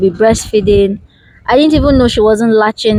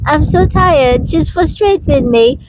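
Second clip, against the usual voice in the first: exhausted mother pleading; 7 semitones higher than usual. Retold or imitated. Wife acting out calling her husband to hold the child, high pleading whine. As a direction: play a worn-out wife begging for help. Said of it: "And you'll be like".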